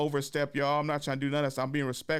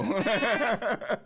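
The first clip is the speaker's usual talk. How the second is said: laughing; 10 semitones higher, 3 dB louder than usual. Real, not acted. Laughing at friend's line.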